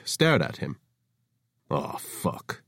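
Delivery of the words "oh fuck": soft, breathy groaning curse; groaned curse. Acted. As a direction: groan it under your breath. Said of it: "he groaned".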